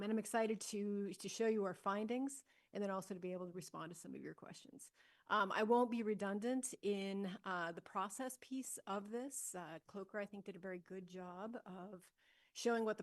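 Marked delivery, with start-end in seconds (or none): none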